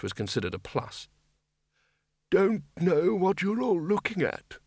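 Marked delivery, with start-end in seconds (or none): 2.3-4.6 put-on high, drawn-out old man's voice; cheerful old wizard. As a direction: chirp it slowly in a raised character voice. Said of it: "said Windle cheerfully".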